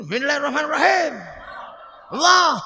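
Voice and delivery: short, forceful shouted recitation; Umar's recitation, clipped and shouted; Umar's harsh recitation. Retold or imitated. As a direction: bark the verse out hard like Umar. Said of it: "Kalau Sayyidina Umar".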